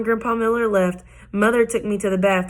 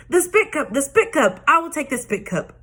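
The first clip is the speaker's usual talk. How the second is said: excited holler; 8 semitones higher than usual. Acted. Junie B overhearing grandpa, hollering with excitement. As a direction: holler with glee. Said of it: "I hollered".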